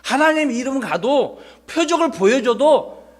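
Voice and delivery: high, slow protesting Moses; reluctant refusal. Acted. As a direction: plead your way out, drawn out and high. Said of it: "모세는 또 거절했어요". (높고 느리게 사정하며 거절하는 말투)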